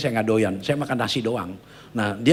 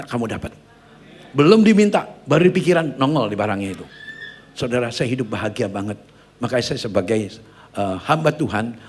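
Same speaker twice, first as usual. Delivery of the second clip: usual speaking voice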